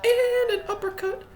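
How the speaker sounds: a sudden high, loud yell as the punch lands; excited shout. Real, not acted. Gamer cheering his own uppercut, loud and high. Landing a big uppercut hit.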